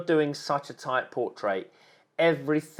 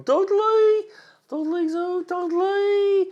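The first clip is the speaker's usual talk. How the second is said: higher, slow mock Dudley accent; bad local accent; 4 dB louder, much slower than usual. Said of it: "I'm awful at accents".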